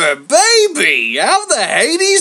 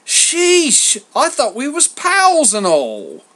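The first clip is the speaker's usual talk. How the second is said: high, loud, whining mock offence; theatrical wounded protest from a schmoozer; mock hurt; 13 semitones higher, 9 dB louder than usual. A narrator's reading. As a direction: play the wounded pal, hammed up. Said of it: "put on a mock look of hurt".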